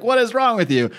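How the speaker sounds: exasperated outcry. Retold or imitated. Rick reasoning with zombies.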